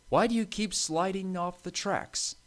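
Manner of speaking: high-pitched scolding question; stern. A narrator's reading. Thin controller questioning Stanley about derailing, stern. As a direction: demand an explanation firmly. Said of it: "he said sternly".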